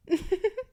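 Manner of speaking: high, delighted mocking giggle. Mistress giggling at her caught pet's naive escape plan. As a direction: laugh at their naive hope.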